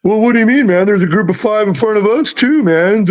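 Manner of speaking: imitating a defensive golf bro; mock golfer bro. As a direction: play the indignant golfer guy. Golfer retorting in retold fight.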